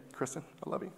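usual speaking voice

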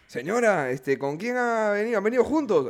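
lightly acted, lilting questions to the audience; show host. Comedian asks a lady in the crowd who she came with, show host.

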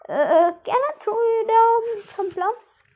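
nervously ingratiating; nervous offer. Acted. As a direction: try to placate the farmer. Medal nervously placates farmer.

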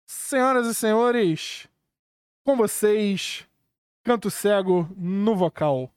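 slow, drawn-out stage announcement; grand entrance announcement. Real, not acted. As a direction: announce the band like a showman. Host introduces band on stage.

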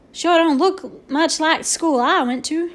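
drawn-out, loud exclamation of disbelief; exclaiming. A narrator's reading. Boone looking at an unfamiliar classroom, exclaiming. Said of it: "exclaimed Boone".

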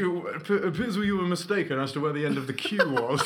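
mock-formal, fast, laughing through it; hammed-up politeness; overly polite Brit. Captain Britain correcting a queue-jumper, mock-formal and giggling. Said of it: "far too polite for that".

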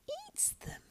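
soft, breath-only creature hiss; hissed whisper. A narrator's reading. Gollum craves the raw rabbit, hissing softly. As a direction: whisper it greedily.

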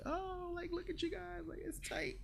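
squeaky high, breathy mock voice; mock awed squeal. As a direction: tease them in a tiny high gasp. Mock cheering on the in-sync duo.

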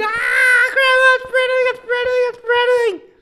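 very high falsetto shriek of joy; high-pitched hammed-up celebration; mock excited squeal. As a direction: echo grandma's glee in a screechy squeal. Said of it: "And I was like".

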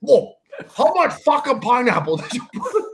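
high, loud outraged outburst; mad dad. Retold or imitated. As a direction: imitate a father blowing up at the clerk. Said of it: "why is he so mad".

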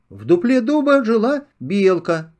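plain reading voice

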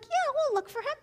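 put-on high chirpy kid voice answering sweetly; a lightly voiced chorus of little girls; eager little kids. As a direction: play the eager fifth graders agreeing.

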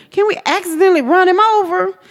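high, quick pleading question; retold mischievous request, high and fast; scheming plea. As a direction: pitch it way up like a hopeful child.

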